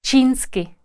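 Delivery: ordinary talk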